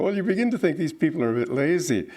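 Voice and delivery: usual speaking voice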